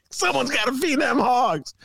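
high, mock rural politician; Darren Bailey. Downstate candidate abroad in the UK, high mock country voice. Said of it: "Darren Bailey".

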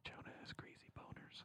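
voiceless teasing whisper. Real, not acted. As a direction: whisper the insult secretly. Teasing whisper behind Jonah's back.

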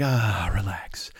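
low, soft, drawn-out sighing voice; body shutting down. Retold or imitated. Voicing the body telling its owner to relax, low sleepy murmur. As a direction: sink into a slow, low, lazy exhale with a soft chuckle. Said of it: "shuts itself down and just like".

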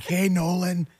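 high-pitched, delighted hello; excited greeting. Retold or imitated. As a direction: greet the kid with a high, playful lilt. Coworkers' excited greeting, acted out.

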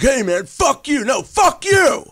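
loud, high-pitched mock shouting match; mad at each other. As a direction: yell it like brothers squabbling, hammed up. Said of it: "get mad at each other".

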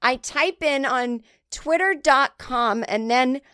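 high, gushing listener voice; mock fan gushing about the podcast; imagined fan voice. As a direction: play a gushing fan talking to her husband.

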